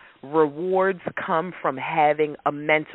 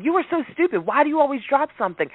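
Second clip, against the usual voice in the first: high-pitched, hurried mock scolding of a child; 9 semitones higher, much faster than usual. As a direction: play a parent snapping at a clumsy kid, high and fast. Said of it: "do you say to your child".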